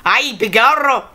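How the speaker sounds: a quick put-on Irish accent, self-corrected after; Irish accent attempt. Vlogger trying out an Irish accent on camera. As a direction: toss off a jokey Irish brogue. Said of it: "No, it's Irish".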